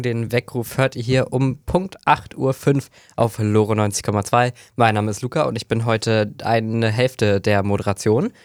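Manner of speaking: usual speaking voice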